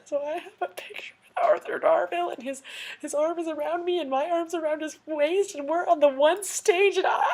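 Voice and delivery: real high, giddy fangirl gush; giddy disbelief, voice climbing high; overwhelmed excitement. Real, not acted. Fangirl overwhelmed telling the climax.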